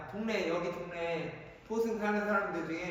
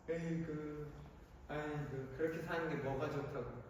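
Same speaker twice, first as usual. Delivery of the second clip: pitying tut-tut; 6 semitones lower, 12 dB quieter than usual. Pretend. Voicing pitying tut-tutting.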